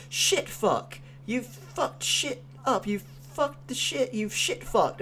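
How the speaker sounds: mock-voicing a character's awkward swearing; imitating Pete's cursing. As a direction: parody a stiff scripted rant. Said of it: "he just says".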